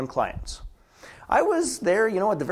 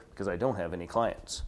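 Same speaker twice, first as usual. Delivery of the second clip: lower, softer, a mock-gloomy admission; glum inside voice; 9 semitones lower, 9 dB quieter than usual. Coach's hidden inner voice admitting no clients, low and soft. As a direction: drop it down, confessing to yourself. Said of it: "the inside voice says".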